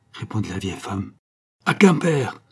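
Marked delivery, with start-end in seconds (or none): 1.7-2.4 high, loud rallying cry. A narrator's reading. Count resolves to go to Quimper, urgent cry. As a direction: cry it out as a command. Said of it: "s'écria le comte".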